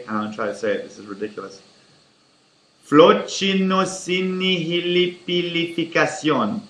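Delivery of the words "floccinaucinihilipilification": Floccinausinihilipilification, said slowly, syllable by syllable; saying a tricky long word. Shown as a demonstration. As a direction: sound out Floccinausinihilipilification carefully. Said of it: "Can you say".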